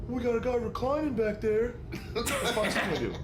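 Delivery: high put-on voice of the captain; mock captain voice. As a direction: imitate the captain tattling. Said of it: "What are you gonna tell the captain?".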